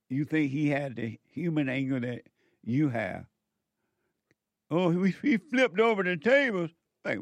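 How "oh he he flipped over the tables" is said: higher, scoffing parody; mock excuse. Preacher parodying believers' excuse about Jesus, higher scoffing tone. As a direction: toss off their excuse in a mocking voice. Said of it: "quoting their excuse".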